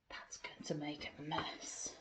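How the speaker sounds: hushed warning aside; whisper. A narrator's reading. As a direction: whisper the worry to the kids. Aside to listeners.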